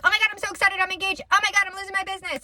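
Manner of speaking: high, breathless mix of joy and panic; frantic inner voice. Retold or imitated. Her nervous system panicking over good and bad news, high and frantic. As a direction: voice the nerves squealing in a rush. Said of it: "my nervous system is freaking out".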